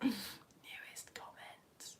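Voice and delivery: very slow, hushed whisper to self; drawn-out whisper while searching. Real, not acted. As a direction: whisper it slowly, barely audible. Muttering while looking through comments.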